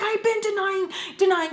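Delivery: high-pitched exasperated retort; worked-up mock protest. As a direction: argue back high and insistent, re-enacting it. Exasperated comeback in acted quarrel.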